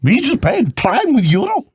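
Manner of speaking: put-on high Dutch-accented drunk voice; hammed-up drunk Dutchman begging for cream. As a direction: Dutch accent, pleading and tipsy. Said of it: "A drunk Dutchman".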